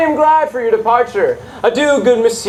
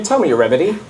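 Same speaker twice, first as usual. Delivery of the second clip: usual speaking voice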